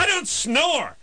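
high, huffy denial; indignant snorer. Retold or imitated. Host mimicking a friend who denies snoring, high and indignant. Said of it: "they get indignant".

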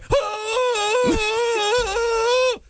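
very high, drawn-out hoot in a mock mother's voice; mock wail held on one high note; long falsetto phone wail. Maid picking up the phone, long high caricature wail. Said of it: "my impression of a Mexican mom answering the phone".

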